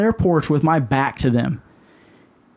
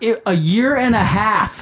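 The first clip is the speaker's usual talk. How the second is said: higher, slow, drawn-out disbelief; exasperated; 5 semitones higher than usual. Retold or imitated. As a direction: stretch it out like it's obvious. Exasperated retort to the wife.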